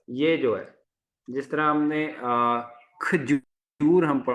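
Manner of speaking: ordinary delivery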